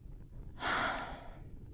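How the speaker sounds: long unvoiced sigh of disappointment; weary sigh. Witch sighs at her lover's stubborn questions. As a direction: let out a tired, put-upon sigh.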